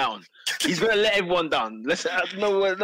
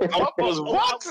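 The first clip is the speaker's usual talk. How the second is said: mock confused squeak; 12 semitones higher, much slower than usual. Mocking the referee's confused question.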